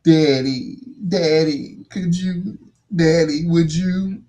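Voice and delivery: high, coaxing mimicry of a girl calling daddy; put-on pleading, pitched well up; imitated daughter's pleading.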